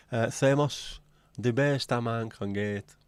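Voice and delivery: slow mock advert slogan, borderline Mexican accent. Host parodying a razor advert slogan for a flask, slow mock ad voice. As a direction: sell it like a slow cheesy ad, half Mexican. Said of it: "he's borderline Mexican there".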